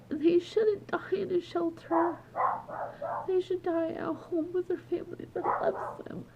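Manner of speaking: real crying, voice held up high; weeping softly while insisting. Real, not acted. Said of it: "I think she knows I'm crying".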